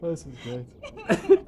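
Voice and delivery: slow, wheezing laugh through the words; can barely get it out for laughing. Real, not acted. Player cracking up at the underwater charade, laughing.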